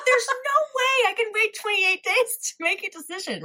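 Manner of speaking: disbelieving, high-pitched protest; high exasperated disbelief. Retold or imitated. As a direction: act out total disbelief. Retelling disbelief at 28 days.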